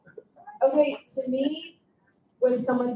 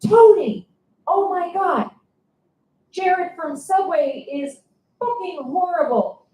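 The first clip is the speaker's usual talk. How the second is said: excited; 6 semitones higher, 3 dB louder, slower than usual. Retold or imitated. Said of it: "and I'm like".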